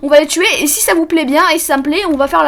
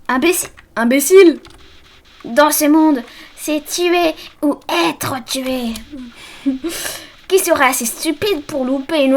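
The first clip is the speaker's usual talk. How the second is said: evil flower voice; 2 semitones lower than usual. Acted. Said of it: "Je lui donne une voix un petit peu comme ça".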